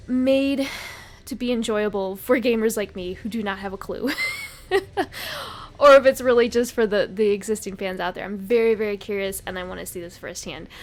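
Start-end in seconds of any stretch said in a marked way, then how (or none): 4.2-5.7 airy high laugh. Real, not acted. Laughs at being clueless.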